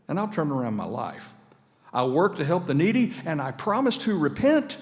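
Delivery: high, loud, desperate begging; desperate pleading prayer. Acted. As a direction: plead with God for your life, loud and high. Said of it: "He said, "God".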